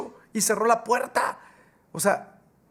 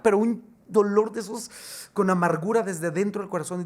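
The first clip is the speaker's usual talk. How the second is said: ordinary delivery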